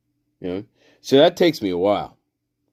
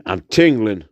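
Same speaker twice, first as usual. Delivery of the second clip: ordinary talk, nothing marked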